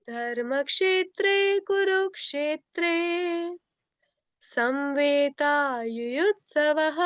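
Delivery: slow, measured chanting of the verse; slow shloka recitation. Shown as a demonstration. Teacher reciting a Gita verse slowly for pupils to follow. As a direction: recite each line slowly and clearly for the class. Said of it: "तो एक बार सब ध्यान से सुन लो पहले".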